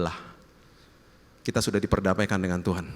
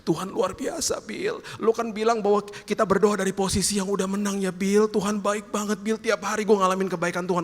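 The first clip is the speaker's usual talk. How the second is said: higher, lightly voiced friend full of faith; a hint of a stage-four patient praising God, higher; voicing a sick friend; 10 semitones higher than usual. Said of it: "ngomongnya gini".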